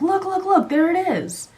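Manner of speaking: imitated high, urgent call; retold excited alert, pitched well up; urgent pointing out. Stepdad pointing at the shark again, imitated urgent call.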